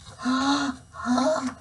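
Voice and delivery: sharp gasping breaths before sneeze; sneeze buildup gasp. Acted.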